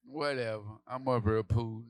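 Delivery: ordinary talk, nothing marked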